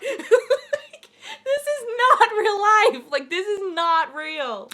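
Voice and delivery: high, drawn-out squeaky disbelief with laughter; overwhelmed fangirl. Retold or imitated. Overwhelmed, squealing after being called cute.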